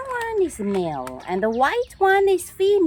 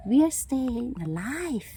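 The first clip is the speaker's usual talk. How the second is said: usual speaking voice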